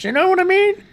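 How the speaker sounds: high-pitched, worked-up check-in; excited high voice. Real, not acted. Host worked up over hypocrites, excited high voice. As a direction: let the voice shoot up high with excitement. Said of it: "The more excited I get, the higher my voice goes".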